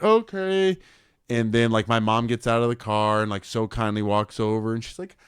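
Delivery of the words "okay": bewildered. Retold or imitated. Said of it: "we're just like".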